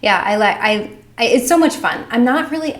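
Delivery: ordinary delivery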